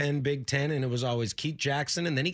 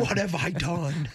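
mock dismay; 6 semitones higher than usual. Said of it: "Once she finds out".

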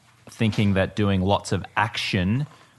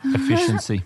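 quip. Real, not acted. Listener quips.

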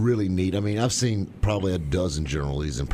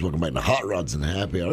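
normal talking voice

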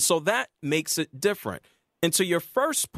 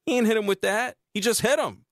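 ordinary talk, nothing marked

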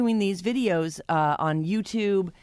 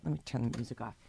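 hushed; 3 semitones lower, 8 dB quieter than usual. Shown as a demonstration. Demoing quiet ASMR sounds.

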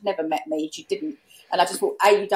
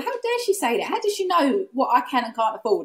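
indignant; 7 semitones higher than usual. Retold or imitated. Indignant inner thought, retold.

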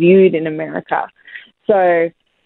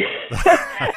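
amused laugh; 10 semitones higher, 5 dB quieter than usual. Real, not acted. Laughing at own cheeky form answer.

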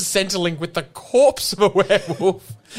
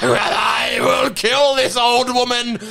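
much higher, shouted werewolf in a hammed-up French Canadian accent; 13 semitones higher than usual. The werewolf fights off Catherine, shrieking high in a hammed-up French Canadian voice. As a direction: yelp and rage like a cornered beast. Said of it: "French Canadian werewolf".

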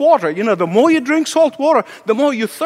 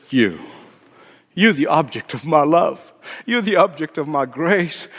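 God speaking. Acted. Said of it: "God says".

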